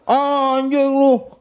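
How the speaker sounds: high, drawn-out scandalised objection; mock parental outrage. Guest imitating a parent rejecting his marriage choice, mock outrage.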